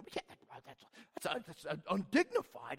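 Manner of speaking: hushed mock scholar, no voice; whispered mock of stuffy scholars. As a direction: breathe it like a scandalized scholar.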